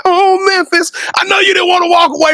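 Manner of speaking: high, wailing cry of Oh, Memphis; mock lament. Host teases coach about leaving, mock wailing. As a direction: ham up a heartbroken goodbye.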